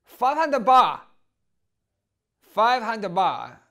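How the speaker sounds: sudden loud, high yell; hammed-up high holler; Thai prostitute's price call. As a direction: blurt the price in a loud put-on voice. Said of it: "she turned to me and she said".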